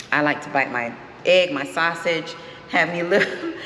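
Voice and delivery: ordinary talk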